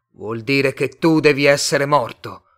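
heated, emphatic deduction; forceful accusation. A narrator's reading. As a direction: drive it home loudly. Tomás heatedly accuses Martian of being dead.

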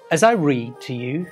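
plain reading voice

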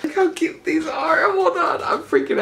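real squeal of delight; excited squeal. Real, not acted. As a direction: squeal over something adorable. Squealing over tiny clay hands.

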